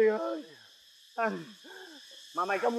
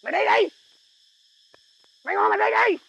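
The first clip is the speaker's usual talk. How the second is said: loud, raised-pitch angry shout; angry dare; 6 semitones higher, 9 dB louder than usual. Acted. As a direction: shout him away, daring him to go. Boss quarrels, orders partner away.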